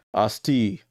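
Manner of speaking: Asti said as AH-stee, two syllables; Asti, AH-stee, stress on the first syllable; correct pronunciation. Shown as a demonstration. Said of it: "pronounce names dot com".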